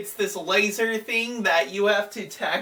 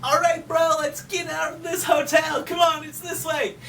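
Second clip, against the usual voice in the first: high, urgent cartoon hero; acted rallying call to a brother, pitched up; Luigi; 8 semitones higher than usual. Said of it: "Luigi's like".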